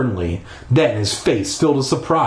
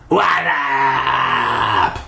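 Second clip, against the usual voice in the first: ghost voice. Acted. Ghost speaks after head attached.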